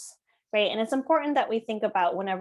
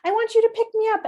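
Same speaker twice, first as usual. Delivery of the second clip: put-on high-pitched, needy little-kid voice; upset child; 5 dB louder, faster than usual. Child wanting to be picked up after misbehaving, high-pitched pleading. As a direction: imitate a child begging for mommy. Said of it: "So they might be like".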